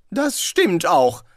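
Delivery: high, slow, affronted assurance; beteuernd. A narrator's reading. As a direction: insist slowly, honour at stake. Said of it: "versicherte er". (gedehnt und hoch beteuert)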